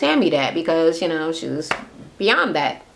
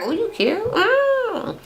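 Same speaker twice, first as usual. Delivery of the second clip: flirty; 13 semitones higher, slower than usual. Retold or imitated. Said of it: "she was like".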